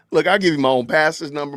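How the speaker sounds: ordinary talk, nothing marked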